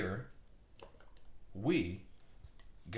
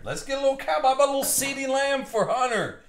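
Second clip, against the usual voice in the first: hyped-up; 8 semitones higher, 5 dB louder than usual. Real, not acted. Hyping up a buyer's hit.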